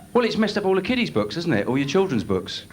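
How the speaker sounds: ordinary talk, nothing marked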